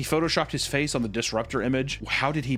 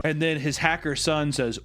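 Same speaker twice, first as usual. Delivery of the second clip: ordinary talk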